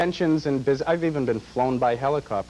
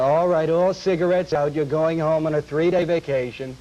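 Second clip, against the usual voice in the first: principal's yell; 4 semitones higher, 5 dB louder than usual. Acted. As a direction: yell it into the room as a mock principal. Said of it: "yell at them".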